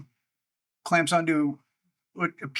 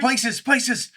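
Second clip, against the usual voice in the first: urgent shout; 7 semitones higher, 5 dB louder than usual. Acted. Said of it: "you hear these guys say".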